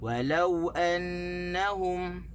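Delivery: slow chant, stress on "lau" in "Walau"; "Walau" stressed on "lau", not on "wa"; correct stress on lam. Shown as a demonstration. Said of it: "Tekan di waw". (tekanan pada lam, perlahan)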